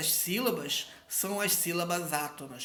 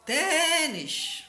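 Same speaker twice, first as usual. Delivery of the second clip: TÊÊÊ-nis, agudo; penúltima sílaba forte; 8 semitones higher than usual. Shown as a demonstration. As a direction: chame Tênis esticando o TÊ. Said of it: "a penúltima sílaba é a mais forte". (Tênis com TÊ agudo e demorado)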